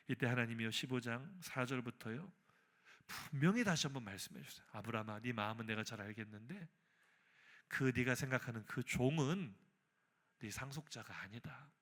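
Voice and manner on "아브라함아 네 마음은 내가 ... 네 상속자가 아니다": low, soft divine answer; calm reassurance. Acted. Preacher as God answering upset Abraham about his heir, calm. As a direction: settle him gently with a lowered voice.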